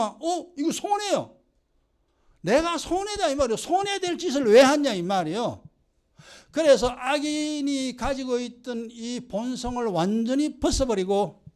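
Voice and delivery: ordinary delivery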